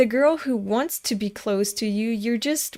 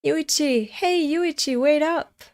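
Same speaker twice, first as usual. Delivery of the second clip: calling out; 5 semitones higher than usual. Acted. Character calling after him.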